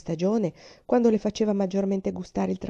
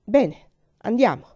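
curt, louder, exasperated; losing patience; 3 semitones higher, 7 dB louder than usual. Acted. Uncle giving in to the screaming girl, curt and exasperated. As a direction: snap it shut, out of patience. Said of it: "perdendo la pazienza".

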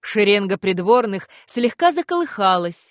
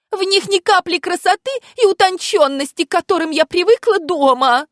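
high, put-on childish little voice; cutesy baby talk; 10 semitones higher, 4 dB louder than usual. A narrator's reading. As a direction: play the helpless little girl, high. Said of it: "нарочито тонким, почти детским голоском". (нарочито тонкий детский голосок)

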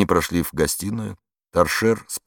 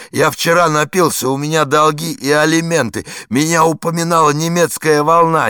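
desperate pleading; 10 semitones higher, 7 dB louder than usual. A narrator's reading. Warden breaks down, begging.

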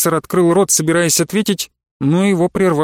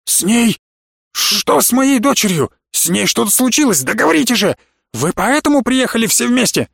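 higher, agitated, indignant questioning; choking indignation; 6 semitones higher than usual. A narrator's reading. Father demanding to know what happened to his daughter, indignant. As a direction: demand answers in rising outrage. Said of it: "профессор поперхнулся собственным возмущением".

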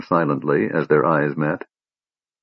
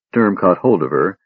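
plain reading voice

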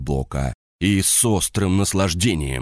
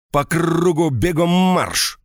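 shouted order; 10 semitones higher, slower than usual. Acted. Officer drilling a subordinate.

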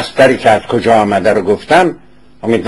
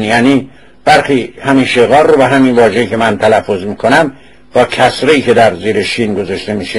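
usual speaking voice

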